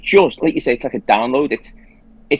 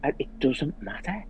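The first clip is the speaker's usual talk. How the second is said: slow, much softer, gently reassuring; kindest voice; 11 dB quieter than usual. Retold or imitated. Telling herself it doesn't matter, slow soft kindness. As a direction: soothe yourself, slow and soft. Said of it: "in the kindest voice".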